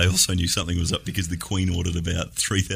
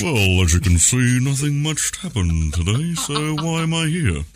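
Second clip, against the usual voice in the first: unhurried newsreader voice; lightly voiced TV newsreader; deep slow voice; 5 semitones higher than usual. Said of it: "I just like talking in a deep slow voice".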